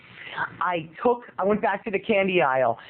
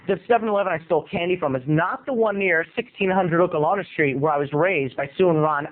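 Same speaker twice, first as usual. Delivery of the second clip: usual speaking voice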